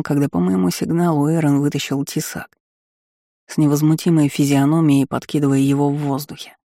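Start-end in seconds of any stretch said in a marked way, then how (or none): none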